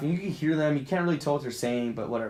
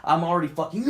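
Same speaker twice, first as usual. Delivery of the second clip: ordinary talk, nothing marked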